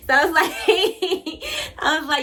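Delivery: high, loud laughing burst; real laughter. Real, not acted. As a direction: laugh while telling it. Laughing at own story.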